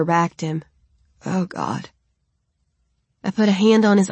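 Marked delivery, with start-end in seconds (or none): 1.2-1.9 pained groan. A narrator's reading. Philip shudders in pain, low and hurting. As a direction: lower and softer, in pain.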